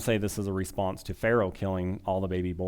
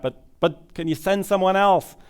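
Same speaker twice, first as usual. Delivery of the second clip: high, louder pleading as an acted Moses; mock begging, pitched up; reluctant plea; 8 semitones higher, 5 dB louder than usual. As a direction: imitate someone wriggling out of a job. Acting out reluctant Moses pleading with God.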